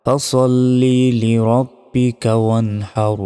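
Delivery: slow melodic tajweed; measured sacred recitation; Quran recitation. Shown as a demonstration. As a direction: chant it, drawing out the long vowels. Recites the verse.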